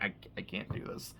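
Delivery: breathless whisper, barely audible; whispered refusal. Retold or imitated. Paperboy whispering that he refuses to perform. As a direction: hush it like you can't bear to say it.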